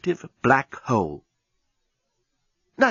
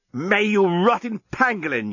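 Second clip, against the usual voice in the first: yelling; 7 semitones higher than usual. Acted. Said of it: "yelled the journalist".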